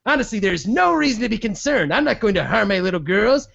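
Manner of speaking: high, sputtering outrage, mock villain; sputtering foiled villain. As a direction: huff like a caught villain. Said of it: "This is Don Sterling giving this interview".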